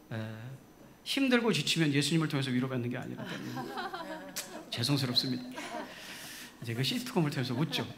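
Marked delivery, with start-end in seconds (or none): 3.3-4.7 high breathy burst of laughter; laughing aside. Real, not acted. Preacher breaking into a high laugh at himself. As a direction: laugh at yourself mid-sermon.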